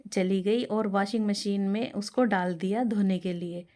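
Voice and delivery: usual speaking voice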